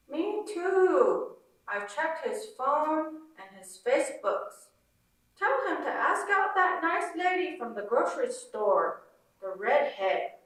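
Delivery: lightly voiced Navajo mom; gossipy Navajo mother, light accent. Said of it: "a Navajo woman's accent".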